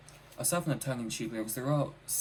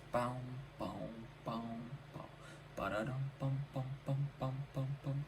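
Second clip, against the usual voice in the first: bouncy sung-out bass line; vocal bass line; 3 semitones higher, 4 dB quieter than usual. Retold or imitated. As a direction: hum the funky bass riff like an instrument. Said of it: "I don't know if that's what the bass sounds like".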